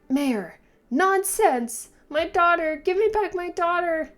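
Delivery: high, loud grieving mother; anguished shout. Acted. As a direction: scream it at the mayor in grief. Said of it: "I understand the voice acting".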